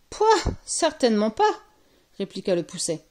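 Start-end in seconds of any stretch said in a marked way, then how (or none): 0.1-1.5 put-on high, loud little-boy disgust; revolted child; disgusted refusal. A narrator's reading. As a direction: reject it with an indignant yuck.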